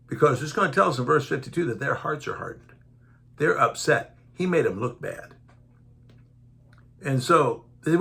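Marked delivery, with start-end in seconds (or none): none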